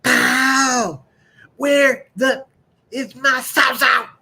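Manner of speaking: high, loud hollering country-dad voice; angry country dad. Retold or imitated. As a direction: yell up the stairs for the lost saw. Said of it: "my dad came thumping up the stairs".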